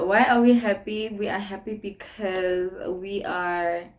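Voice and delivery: usual speaking voice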